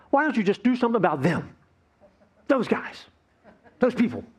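high, mock-indignant protest; aggrieved complaint. Listener protesting to God about other people, aggrieved. Acts out feeling picked on.

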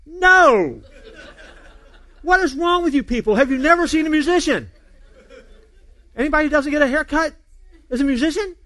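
high, loud mock outrage; hammed-up exasperation, pitched high; exasperated outburst. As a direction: retell it as a comic fed-up rant. Third time asked, mock outrage.

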